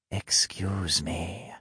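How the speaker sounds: measured, eerie politeness; unfamiliar elven woman. A narrator's reading. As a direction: draw it out politely in an otherworldly woman's voice. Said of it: "The unfamiliar voice came from".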